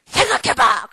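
loud, emphatic order; child's command. Acted. Child to stopped classmates, commanding loudly. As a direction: act out a bossy little leader. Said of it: "그러더래요".

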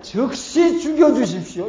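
mildly hammed-up defiant begging, drawn out; defiant plea. Moses talks back to God under the people's burden, defiant plea. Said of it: "막 하나님께 되들었잖아요".

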